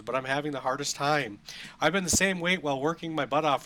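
high, loud, emphatic read-aloud; overplayed letter-reading voice; dramatic email reading. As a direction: read the listener's plea with hammed-up emphasis. Reads listener email aloud.

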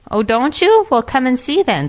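high, loud, indignant comeback; affronted storybook snake; indignant challenge. A narrator's reading. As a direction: rise sharply in pitch, daring the doubter. Snake challenged by doubting fox.

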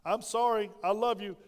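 ordinary talk

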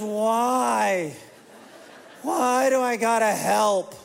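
put-on slow, whining ten-year-old voice; whiny kid. Dad imitating son Ashton refusing dishes, slow whine. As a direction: whine like a kid dodging chores.

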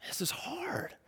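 breathless whisper. Real, not acted. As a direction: let it slip out in a whisper. Pastor confessing struggle.

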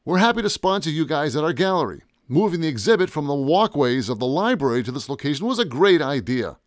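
lifted, lively host's delivery; announcer voice. Acted. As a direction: address the room like an event announcer. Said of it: "In my best announcer voice".

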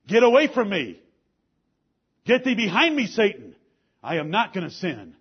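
loud, high command fading to firm resolve; stern rebuke. Acted. Jesus ordering Satan behind him, loud stern command. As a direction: drive the tempter away.

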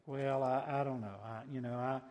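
low, halting mumble of doubt; trailing, soft-spoken reluctance to believe; skeptical hedging. Acted. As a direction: hem and haw, unsure and noncommittal. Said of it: "my first response is to be".

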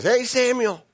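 high, hammed-up cheery hail; over-friendly high call; Saul. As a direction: call out a breezy mock greeting. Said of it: "And here he is".

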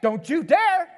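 mother warning grandpa off the playpen; warning mom. Retold or imitated. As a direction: stop Dad cold like an angry mom. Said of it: "My sister comes around the corner".

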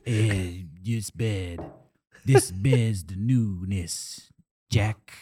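low, slow, oddly flat salesman drawl; weird salesman voice. Retold or imitated. Mocking the salesman's weird delivery to friends, low and slow. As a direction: mimic the strange waterbed salesman, low and slow. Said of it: "the waterbed salesman is just talking fucking weird".

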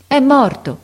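high, loud narrated outcry; boy's cry. A narrator's reading. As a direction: cry out the news of a death. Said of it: "gridò il ragazzo".

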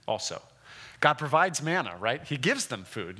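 ordinary talk

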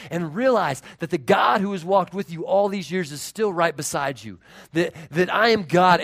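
loud, driving preacher's urging; forceful exhortation. Acted. God urging Elijah out of the cave, forcefully. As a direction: rouse him with mounting volume. Preacher voices God's rousing command.

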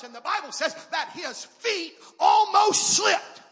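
loud, slow preacher's line; slow shouted preaching. Acted. Pastor telling the Bible story, shouting. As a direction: lean hard on every word.

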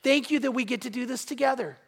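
ordinary talk, nothing marked

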